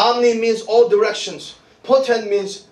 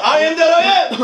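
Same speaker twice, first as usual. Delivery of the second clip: higher-pitched, urgent preacher's call; rallying call; 6 semitones higher, 3 dB louder than usual. Real, not acted. Preacher urging the congregation to declare it, rallying call. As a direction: urge the crowd to join in. Urges congregation to repeat louder.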